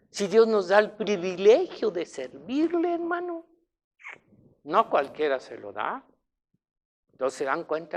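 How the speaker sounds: ordinary talk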